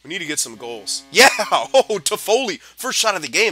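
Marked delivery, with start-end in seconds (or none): 1.1-3.5 real joyful shout, much higher and louder; excited cheer. Real, not acted. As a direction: burst out cheering at the goal. Goal scored in his game.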